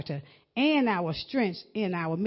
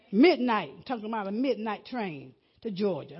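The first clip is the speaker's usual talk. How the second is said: ordinary talk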